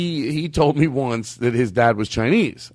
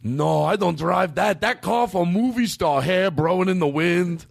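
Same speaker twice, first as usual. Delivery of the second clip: put-on dad's broken-English accent; 7 semitones higher than usual. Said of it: "This is how his dad sounded".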